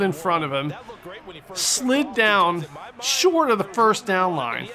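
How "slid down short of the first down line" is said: normal talking voice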